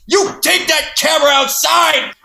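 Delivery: very high, loud shriek; mock old man exploding out of nowhere; angry old man. Imitating old guy charging at the camera, explosive yell. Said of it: "I remember one guy".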